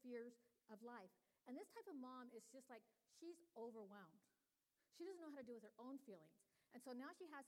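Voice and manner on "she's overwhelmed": normal talking voice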